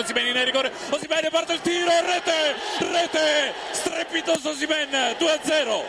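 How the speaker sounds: high shouted goal call; breathless italian goal commentary. Real, not acted. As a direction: scream rete twice, soaring high. Osimhen scores second goal.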